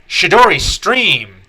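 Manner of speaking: loud, high attack-name shout; commanding attack call. Acted. Sarda commands an electric attack, commanding shout. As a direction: call out your special move with force. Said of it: "commands here a".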